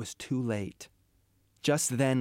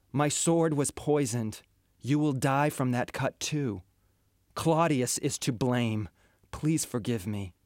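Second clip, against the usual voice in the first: usual speaking voice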